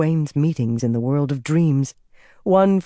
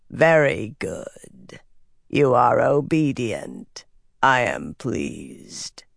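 slow, low rasping creature; 4 semitones lower, much slower than usual. A narrator's reading. Dark figure pleased by her obedience, rasping. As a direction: rasp it out slowly, sinking very low. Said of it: "a rasping voice said".

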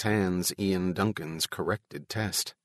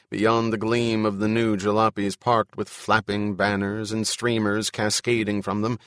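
ordinary delivery, nothing marked

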